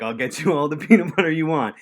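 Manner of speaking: hammed-up captain laying down his rule; imitating Nick. Nick voiced about peanut butter.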